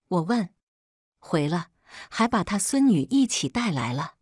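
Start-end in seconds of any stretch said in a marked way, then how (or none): none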